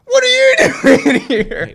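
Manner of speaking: very high, loud, slow, squealed through laughter; laughing echo. Acted. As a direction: throw the question back, cracking up. Burglar echoes the kid's question.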